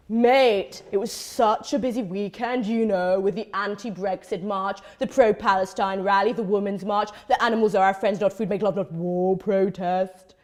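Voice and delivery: low-pitched mock activist rattling off a list; posturing activist. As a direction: impersonate a name-dropping march-goer, low and emphatic. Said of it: "and proceeds".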